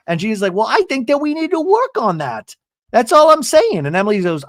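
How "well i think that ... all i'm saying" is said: high-pitched mock self-justifying; insist, getting louder at the end; retold defensive protest. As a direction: imitate a friend pushing back. Acted defensive reply.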